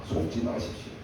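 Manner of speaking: low, near-whispered warning; quiet urgent plea; hushed warning. Real, not acted. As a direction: drop to a breathy hush. Warning the congregation not be fooled.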